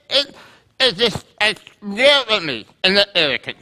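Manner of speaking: halting, stammering, mumbled excuse with nervous laughs; stuttering mumble. Retold or imitated. Preacher mimics Moses mumbling his stammered excuse. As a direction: stumble through the words like Moses. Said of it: "Moses is essentially doing this".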